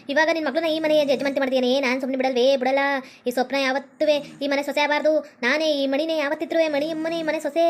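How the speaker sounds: menacing ghost. Acted. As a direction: growl out the curse on the house.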